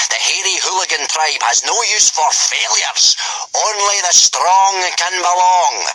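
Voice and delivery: high, hearty booming voice; cheerful Gobber. A narrator's reading. Gobber threatening the boys, cheerful. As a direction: say it cheerfully like a Viking teacher. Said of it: "Gobber continued cheerfully".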